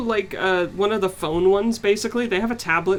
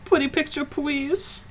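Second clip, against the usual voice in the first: put-on high pleading baby talk; 8 semitones higher, slower than usual. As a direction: beg sweetly like a toddler. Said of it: "doing the baby voice".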